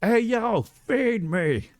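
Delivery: very high cartoon cat voice calling out; squeaky little pet demanding food; voicing the demanding cat. Owner voicing her cat calling for food, very high and demanding.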